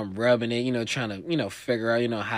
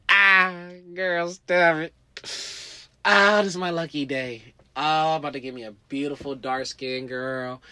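giddy delight; 2 semitones higher, 8 dB louder than usual. Retold or imitated. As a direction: revel in your lucky day. Thinking he's lucky, excited.

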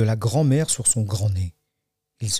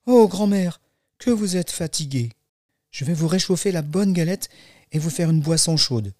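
put-on higher, childlike, solicitous voice; raised storybook child voice; little girl; 5 semitones higher than usual. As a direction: fuss over granny as a little girl. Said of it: "dit-elle".

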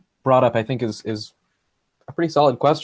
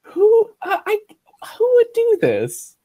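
incredulous, rising; 5 semitones higher, 4 dB louder, slower than usual. Retold or imitated. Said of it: "I was just like".

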